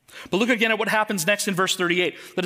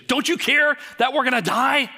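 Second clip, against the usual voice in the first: bold accusation; 7 semitones higher, 4 dB louder than usual. Retold or imitated. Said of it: "A bold accusation".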